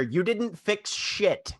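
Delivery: high, drawn-out mock yell; Graz. Graz to Crack while running into combat, mock yell over the shoulder. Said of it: "Graz yells over their shoulder".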